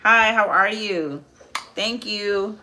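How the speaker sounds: ordinary talk, nothing marked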